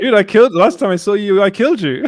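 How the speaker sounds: high, loud mock outrage; mock excited shout. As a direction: play it up like a gleeful joke. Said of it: "It's like".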